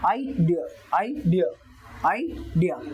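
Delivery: Idea with डी stressed. Shown as a demonstration. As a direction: lean on the डी syllable. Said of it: "डी पे ज्यादा stress है".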